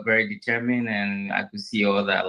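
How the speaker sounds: normal talking voice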